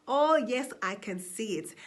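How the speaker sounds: ordinary talk, nothing marked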